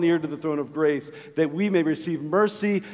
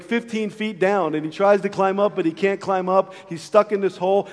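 ordinary talk, nothing marked

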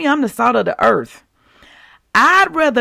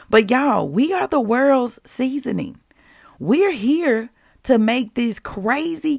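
ordinary delivery

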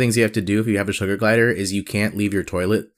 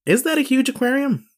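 incredulous. Real, not acted. Doubting size of tank.

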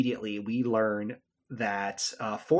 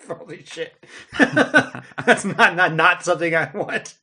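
laughing in disbelief, louder and higher; shocked laugh; 6 semitones higher, 10 dB louder, slower than usual. Real, not acted. Host reacts to the cut scene, shocked laughter.

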